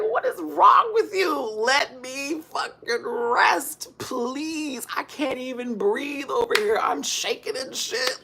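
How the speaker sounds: very high, loud screech at the cats; acted-out frantic screaming rant; exasperated yelling. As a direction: yell it drawn out, pleading and fed up. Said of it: "I had to yell at them".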